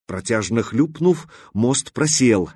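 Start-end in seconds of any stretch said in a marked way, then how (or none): none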